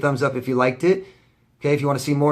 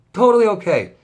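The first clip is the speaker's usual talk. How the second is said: sarcastic; 9 semitones higher, 8 dB louder than usual. Acted. Sarcastic repeat, louder and higher.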